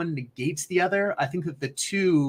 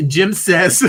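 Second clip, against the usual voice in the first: laughing; 4 semitones higher, 6 dB louder than usual. Real, not acted. Laughing while reading chat.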